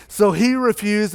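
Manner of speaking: ordinary talk, nothing marked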